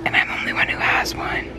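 secret whisper to the camera. Real, not acted. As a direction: whisper it so nobody hears. Secret gift plan told to the camera.

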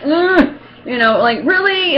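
high, loud "Really?" in disbelief; pained disbelief. Retold or imitated. Numb patient reacting to pain. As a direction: wince and protest. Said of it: "I'm like".

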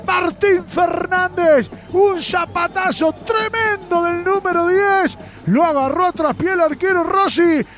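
excited goal commentary. Real, not acted. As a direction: ride the thrill of the goal. Excited post-goal play-by-play.

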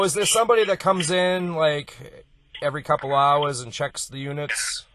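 ordinary talk

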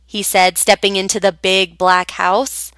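plain reading voice

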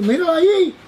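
loud high-pitched holler, last word stretched; imitated shouting. Drunk man pointing him out to his friend, imitated shout. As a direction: yell to point someone out. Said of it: "Pero gritaba ahí en el medio".

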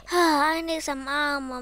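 ordinary talk, nothing marked

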